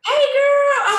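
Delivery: high, loud, bright hello to a friend; excited greeting. Real, not acted. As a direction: squeal the greeting happily. Excited greeting on video call.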